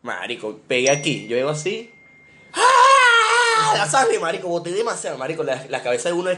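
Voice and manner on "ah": long, very high loud wail; re-enacted scream. Recalls hitting head in the fall, screaming. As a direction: scream like a hurt kid.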